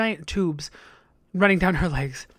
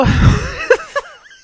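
burst of laughter; 8 dB louder than usual. Real, not acted.

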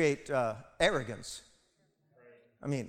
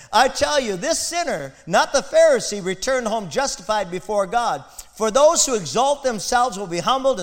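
higher and louder, full preaching force; earnest loud quote; 4 semitones higher, 8 dB louder than usual. Retold or imitated. Preacher quotes Jesus to his congregation, loud. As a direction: proclaim the tax collector's plea. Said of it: "He said".

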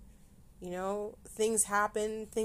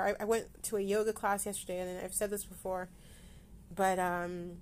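usual speaking voice

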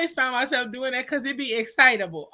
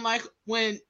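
usual speaking voice

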